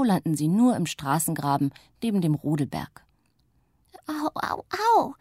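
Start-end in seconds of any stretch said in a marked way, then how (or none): 4.1-5.1 moaning in pain. A narrator's reading. Little witch landed in the ditch, moaning ow. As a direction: whimper from the fall. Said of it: "stöhnt Zuckerguss".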